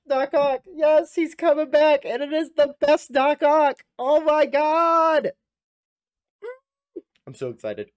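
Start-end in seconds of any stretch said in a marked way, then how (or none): 0.1-5.3 high, loud, stretched out with joy; ecstatic shouting. Real, not acted. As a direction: drag out the words in delight. Fan hears Doc Ock returns.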